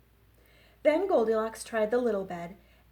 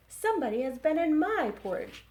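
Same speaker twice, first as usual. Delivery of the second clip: higher, louder little bear voice; Baby Bear; 6 semitones higher, 5 dB louder, faster than usual. Acted. Librarian reading to kids, Baby Bear higher.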